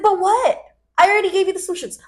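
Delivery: high, rapid exasperation; fed-up yelling. Retold or imitated. Cutting off a friend's excuses, fed up. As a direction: fire it back in retold exasperation. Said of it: "screaming at her 'cause I'm so fed up".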